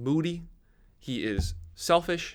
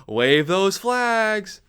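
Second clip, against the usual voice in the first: loud, higher and very slow announcer call; mock stadium hype; 7 semitones higher, 7 dB louder, much slower than usual. Gronk on the big screen telling fans, slow loud mock hype call. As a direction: drag it out like a hammed-up hype man. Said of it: "Gronk comes on saying".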